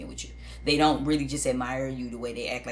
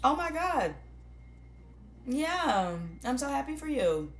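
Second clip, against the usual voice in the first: much higher, gushing mock praise; over-the-top phony delight; fake congratulations; 10 semitones higher than usual. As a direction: squeal out hollow congratulations. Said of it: "It's fake".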